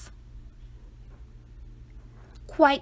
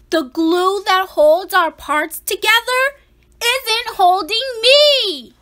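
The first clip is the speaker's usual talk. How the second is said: higher and louder, slowing on the last words; alarmed realization; 6 semitones higher, 5 dB louder than usual. Acted. Frightened boy announcing his body is falling apart. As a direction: let the fear rise as the awful truth lands.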